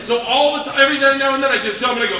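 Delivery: ordinary talk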